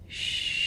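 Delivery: long, soft unvoiced "shh"; soothing shush. Mentor letting thanks sink in, soothing shush. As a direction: hush them gently, drawing it out.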